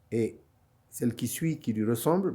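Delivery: normal talking voice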